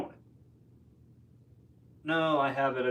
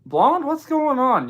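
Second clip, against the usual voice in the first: much higher and louder, slow and stretched out; excited greeting; 14 semitones higher, 9 dB louder, slower than usual. Real, not acted. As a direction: call out happily to a friend who just showed up. Greeting a viewer who arrived.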